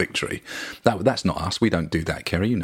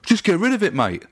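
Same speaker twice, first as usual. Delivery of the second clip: put-on grumpy old fan; 11 semitones higher than usual. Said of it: "as if to say".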